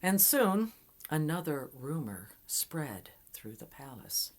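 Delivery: ordinary delivery, nothing marked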